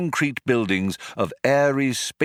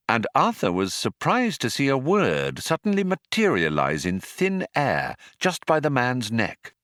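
plain reading voice

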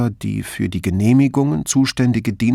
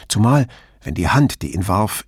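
usual speaking voice

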